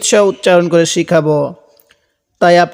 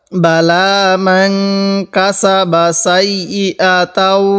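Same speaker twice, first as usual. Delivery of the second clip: slow, drawn-out reading of the verse; slow recitation; much slower than usual. Shown as a demonstration. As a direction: stretch each syllable like recitation. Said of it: "এরকমভাবে পড়ব". (ধীরে টেনে পড়া)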